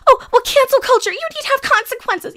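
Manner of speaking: high, condescending put-on voice; mocking imitation. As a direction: sneeringly mimic a patronizing troll. Mimics cancel culture people.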